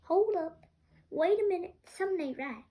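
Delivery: high, loud double take, easing by the end; mock alarm. Host imagining finding one in the curry, mock alarm. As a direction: call a sudden halt in playful disbelief. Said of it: "so I'll be like".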